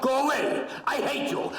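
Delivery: high, forceful shout; demonstrated shout. Acted. Doctor showing patient what to shout when alone, shouting. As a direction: show how to shout them off.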